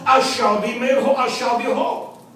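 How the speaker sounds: usual speaking voice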